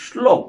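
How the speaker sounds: শ্লোক begun with sh, "shlok"; wrong sh pronunciation. Shown as a demonstration. Teacher warning students off শ্লোক said with sh. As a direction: say শ্লোক with sh in place of s. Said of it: "উচ্চারণ হয় না".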